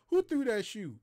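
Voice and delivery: a hint of an angry sergeant asking; sergeant demanding. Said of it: "And he was like".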